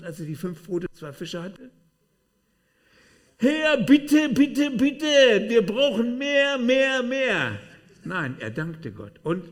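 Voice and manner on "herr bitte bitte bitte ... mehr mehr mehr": very high, loud wailing plea; mock desperate begging. Preacher parodies praying for more bread, loud high begging. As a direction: beg at full volume, hammed up. Acts out imagined desperate prayer for food.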